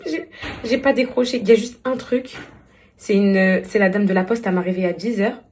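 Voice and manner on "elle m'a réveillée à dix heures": normal talking voice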